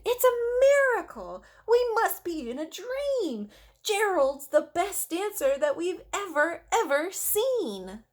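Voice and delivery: high, loud astonished cheering; amazed shout. Acted. Animals shouting in amazement at Gerald's dancing. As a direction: marvel out loud. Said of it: "They shouted".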